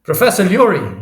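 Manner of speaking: loud, high shout after someone; calling out. A narrator's reading. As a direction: call urgently down a corridor. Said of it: "he calls".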